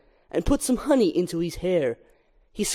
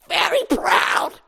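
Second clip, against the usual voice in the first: scolding mother; 11 semitones higher, slower than usual. Acted. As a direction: scold him for skipping the stairs. Mother fussing over Barry.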